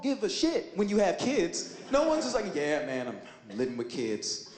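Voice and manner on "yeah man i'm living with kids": mock somber confession. Mock AIDS-patient confession.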